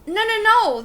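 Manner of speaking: high, loud, hurried protest; frantic. A narrator's reading. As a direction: cut him off in a panic. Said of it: "the man said frantically".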